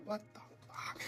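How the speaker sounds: breathless whispered disbelief; stunned whisper. Retold or imitated. Reacting as a stranger pays his bill, stunned whisper. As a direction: mouth it in shock under your breath.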